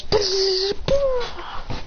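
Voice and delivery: high buzzing noise; pitched buzzing sound effect. Retold or imitated. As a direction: buzz like spinning energy. Imitated spinning energy noise.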